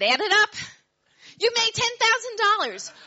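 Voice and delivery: high and loud, jubilant; triumphant shout. Retold or imitated. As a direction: announce the win, overjoyed. John triumphantly insisting.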